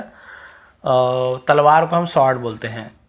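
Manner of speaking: ordinary talk, nothing marked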